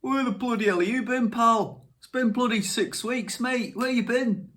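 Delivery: high, put-on voice of a pal welcoming him back; squeaky high mock-scolding mate; mock greeter. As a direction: play a cheeky mate scolding you for being away. Mock greeting to returning YouTuber.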